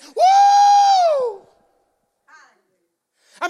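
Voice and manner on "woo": very high, loud, sustained whoop; praise whoop. Shown as a demonstration. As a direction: let out a long ringing "woo" of praise. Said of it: "I like to do it like this".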